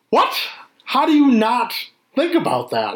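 high-pitched incredulous outburst, dropping at the end; exasperated disbelief. Retold or imitated. Viewer to the hero about the tracker, exasperated. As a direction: imitate a viewer baffled by a plot hole.

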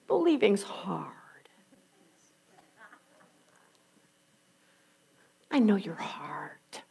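soft, slow, whispered comfort; tender whisper. Acted. Jesus comforts the grieving travellers in a slow whisper. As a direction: soothe them gently.

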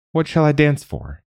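high, lightly challenging question; countering. A narrator's reading. Girl bargains with the crow queen, lightly countering. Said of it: "Mag countered".

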